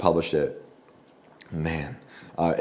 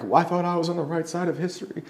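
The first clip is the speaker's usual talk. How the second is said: high, mocking sing-song of a self-righteous friend; mocking whiny friend; 10 semitones higher than usual. Mocking the crying friend who called him at midnight. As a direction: parody the friend's pious complaint. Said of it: "I hate that phrase".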